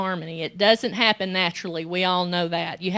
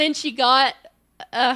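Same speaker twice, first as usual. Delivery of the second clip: ordinary talk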